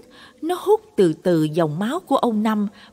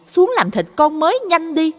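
sharp-tongued wife, louder and fast at the end; shrill nagging; 5 semitones higher, 5 dB louder, faster than usual. A narrator's reading. Wife scolding husband to hurry and butcher the dog, sharp nagging. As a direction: nag him, getting faster and louder. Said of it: "giọng vợ ông Năm chua ngoa".

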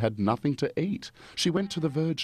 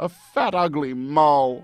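much higher, louder self-pitying wail; loud moaning whine; 7 semitones higher, 7 dB louder than usual. Acted. Stans wailing to the Virgin about her looks, high and loud. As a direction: wail it out like a sulky brat. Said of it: "moaned Stans".

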